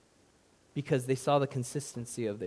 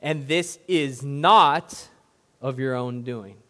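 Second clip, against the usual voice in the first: not slowed and leaned on; slow emphatic reading, stress on not; 8 dB louder, slower than usual. Shown as a demonstration. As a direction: drag out not, then ease off. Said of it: "One more time for emphasis".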